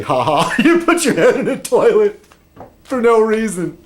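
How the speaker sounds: high, laughing taunt; mocking laughter. Laughing at John for his toilet video, high and mocking. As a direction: laugh through a gleeful tease.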